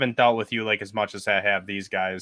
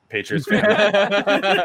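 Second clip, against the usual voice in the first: slow, higher, laughing insult; laughing jab; 9 semitones higher, 4 dB louder, much slower than usual. Real, not acted. Host teasing Keith's team, laughing jab. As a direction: tease him through laughter.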